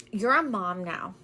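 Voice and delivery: ordinary talk, nothing marked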